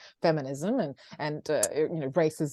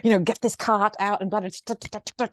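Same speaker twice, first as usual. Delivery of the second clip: usual speaking voice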